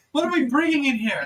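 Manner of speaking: loud, high, urgent demand; alarmed high shout; yelling doctor. Acted. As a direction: yell it across a busy medical room. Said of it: "another doctor yells".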